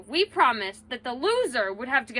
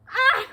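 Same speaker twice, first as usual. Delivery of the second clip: gasp from ice water; 9 semitones higher, 3 dB louder than usual. Real, not acted. Ice water poured on head.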